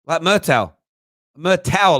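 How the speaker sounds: Myrtle said as mer-tel, with an l; pronouncing Myrtle. Retold or imitated. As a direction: imitate the Lethal Weapon guy saying Myrtle. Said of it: "I think it was Myrtle".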